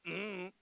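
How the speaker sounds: closed-mouth sulky grunt. Retold or imitated. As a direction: grunt it like a sulking boy. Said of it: "He's just grunting at me".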